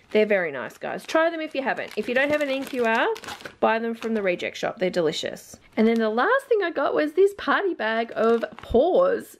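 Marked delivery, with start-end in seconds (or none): none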